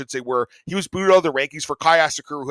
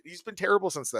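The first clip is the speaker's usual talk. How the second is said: ordinary delivery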